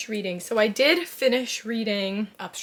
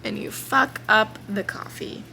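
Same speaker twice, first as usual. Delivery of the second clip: ordinary delivery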